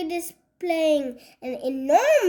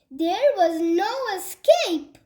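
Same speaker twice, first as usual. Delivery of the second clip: plain reading voice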